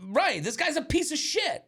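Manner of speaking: annoyed guy fuming about the bar intruder. Retold or imitated. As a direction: spit it out in disbelief. Annoyed inner thought retold.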